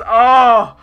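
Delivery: real happy tearing up. Real, not acted. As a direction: let the emotion catch in the throat. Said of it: "I'm tearing up a little".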